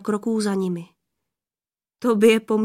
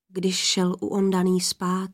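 ordinary delivery

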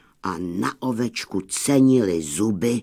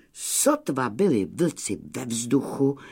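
ordinary delivery, nothing marked

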